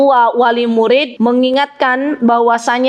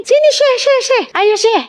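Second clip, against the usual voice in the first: much higher, playful, sing-song call to a little one; calling a small child; 11 semitones higher than usual. Shown as a demonstration. Teacher calling a small child over, high and playful. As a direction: call the small boy over brightly, repeating his title. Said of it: "kita bilang manggil gini".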